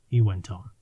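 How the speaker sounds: ordinary delivery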